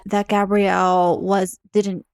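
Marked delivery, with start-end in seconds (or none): none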